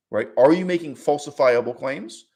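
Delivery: ordinary delivery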